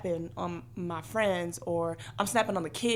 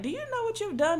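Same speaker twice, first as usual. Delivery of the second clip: high, incredulous question; a raised-up imitation of an amazed therapist; mock shocked therapist; 13 semitones higher than usual. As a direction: play the stunned therapist asking it. Therapist confronting her, retold.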